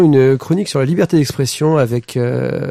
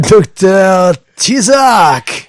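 drawn-out high, loud fighter call; ring announcer; 6 semitones higher, 7 dB louder, much slower than usual. Acted. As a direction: stretch the name like a boxing announcer.

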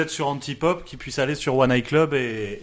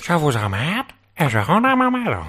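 imitation; 3 semitones higher, 3 dB louder than usual.

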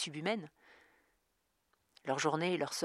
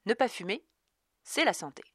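reedy voice, a little higher; thin little voice; 6 semitones higher than usual. A narrator's reading. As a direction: slim piping slogan voice. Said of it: "d'une voix fluette".

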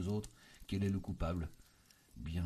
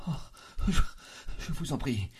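halting, high-pitched begging; pained, faltering entreaty; anguished plea; 6 semitones higher, 5 dB louder than usual. A narrator's reading. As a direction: gasp and stammer into the plea. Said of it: "une sorte de cri de souffrance".

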